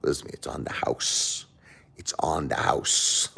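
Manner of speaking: hushed wise-guy mumble, sinking to a whisper; Robert De Niro. Acted. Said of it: "Robert De Niro say".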